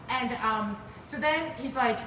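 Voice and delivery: normal talking voice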